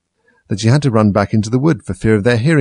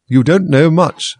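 rude Duchess; 7 semitones higher, 6 dB louder, slower than usual. A narrator's reading.

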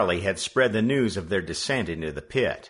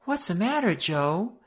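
put-on frightened girl; 12 semitones higher than usual. A narrator's reading. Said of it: "she asked tremulously".

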